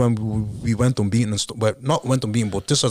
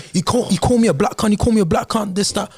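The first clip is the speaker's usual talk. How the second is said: put-on a light London accent, higher and fast, whining to the ref; mock complaint; 9 semitones higher, faster than usual. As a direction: rattle off the opponent's accusation in his London accent. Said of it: "the fella was like".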